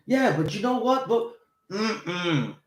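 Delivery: high, chipper mock yammering; hammed-up pest who won't let you leave; mock clingy talker. Mocking the person who keeps the chat going, high mock eagerness.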